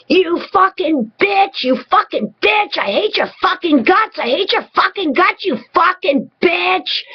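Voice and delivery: hammed-up falsetto rant, stretching the insults; mock furious tirade. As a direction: imitate a partner's tantrum in a squeaky-high voice. Acting out partner's furious tirade.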